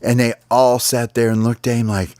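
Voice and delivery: plain reading voice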